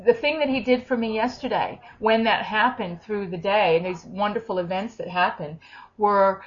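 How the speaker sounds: usual speaking voice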